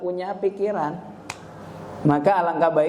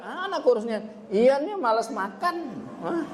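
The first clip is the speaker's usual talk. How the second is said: complaining parent; 7 semitones higher than usual. Retold or imitated. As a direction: lightly imitate a parent complaining. Orang tua mengeluh soal anak. (orang tua mengeluh, nada tinggi dan lambat)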